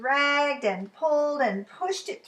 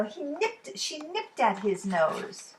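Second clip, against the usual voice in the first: ordinary delivery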